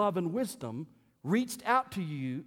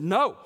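a flat, loud, final no; a firm refusal to the pastor; emphatic no; 4 semitones higher, 8 dB louder than usual. Retold or imitated. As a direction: shut the question down hard. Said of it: "It was a very emphatic no".

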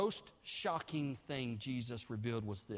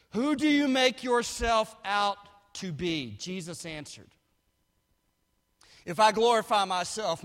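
loud, higher preaching of Jesus' reply; full-force preaching; 5 semitones higher, 11 dB louder than usual. Acted. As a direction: proclaim it boldly to the hall. Preacher voicing Jesus' answer.

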